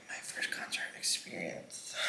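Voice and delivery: soft whispered cheer; hushed tipsy proclamation. Retold or imitated. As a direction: breathe it out in a drunk whisper. Drunk, throwing certificate in air.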